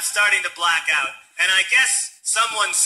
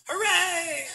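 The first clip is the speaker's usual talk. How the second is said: mock cheer; 12 semitones higher, 5 dB quieter than usual.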